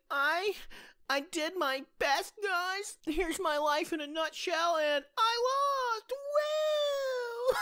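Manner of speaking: hammed-up reality show sob story, slowing; sad contestant message. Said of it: "sad message to the fans".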